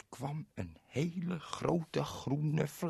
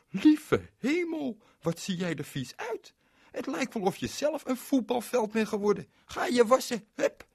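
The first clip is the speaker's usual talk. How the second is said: a startled mum scolding; shocked mother; 13 semitones higher, 5 dB louder than usual. Acted. Mother sees how dirty Berend is, high shocked scolding.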